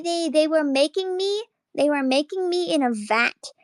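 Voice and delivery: ordinary talk, nothing marked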